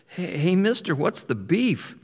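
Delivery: put-on high frightened young beaver; scared kid voice. A narrator's reading. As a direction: squeak it out, startled. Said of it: "still scared and startled".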